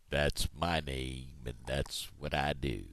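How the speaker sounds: low, slow, drawn-out cow voice. Acted. Cow finishes introducing itself to frog, low and slow. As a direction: plod through it low and unhurried. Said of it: "I'm a cow".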